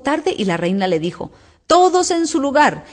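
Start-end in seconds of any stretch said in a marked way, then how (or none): 1.7-2.8 high-pitched commanding shout from the queen; thunderous shout. A narrator's reading. The queen orders the crowd to their places, shouting high. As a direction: bark the order to the whole court. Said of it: "gritó en eso la reina con voz de trueno".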